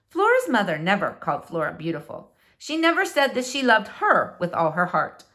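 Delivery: ordinary delivery, nothing marked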